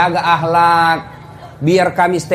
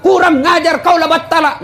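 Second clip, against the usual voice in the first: angry retort; 9 semitones higher than usual. Retold or imitated. Acted angry wife retort.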